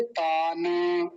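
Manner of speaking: ordinary delivery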